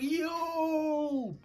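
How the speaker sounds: very high, drawn-out "yo"; high-pitched retold outrage; scandalized. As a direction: squeal it in exaggerated disbelief. Acted outraged reaction to gossip.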